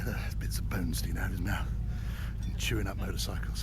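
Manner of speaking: hushed, soft whisper. Real, not acted. Rider admiring a statue at Deal's Gap, whispering. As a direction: breathe it like you're in a museum.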